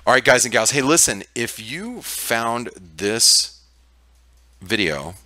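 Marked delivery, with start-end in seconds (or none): none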